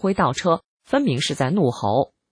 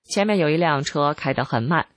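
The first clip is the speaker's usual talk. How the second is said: usual speaking voice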